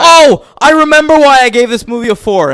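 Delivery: high, loud, fast outburst; loud rush of indignant recall; sudden exclamation. Real, not acted. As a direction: blurt it out as it comes back to you. Sudden recollection of a grievance.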